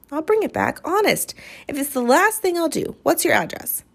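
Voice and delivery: put-on high, eager boy pleading to borrow a book. Lightly voiced boy begging the girl for a book. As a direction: lift the voice into an earnest kid begging.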